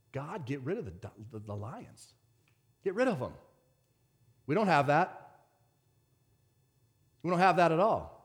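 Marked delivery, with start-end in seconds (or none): 0.1-3.3 soft, small praying voice; mock quiet plea. Daniel asking God to remove the lions, mock quiet plea. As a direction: plead under your breath, as an imagined prayer. Imagined timid prayer by Daniel.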